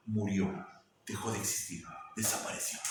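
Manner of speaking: fading into a whisper; whispered fade. Acted. Preacher describing Saul's old world dying, whispered. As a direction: let the words vanish in a whisper. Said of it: "voice dies away".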